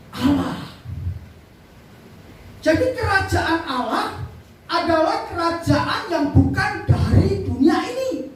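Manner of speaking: rising, drawn-out proclamation with weighty pauses; emphatic preaching. Real, not acted. Preacher expounds God's kingdom to congregation, emphatic preaching. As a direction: preach it out to the hall, punching key words.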